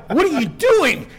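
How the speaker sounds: high, loud startled cry; shocked yell. Retold or imitated. Startled man yelling at the woman on top of him. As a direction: blurt it out in shock.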